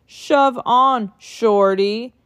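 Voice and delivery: gruff shove-off call; mild mock yell. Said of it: "yelled a double-decker bus".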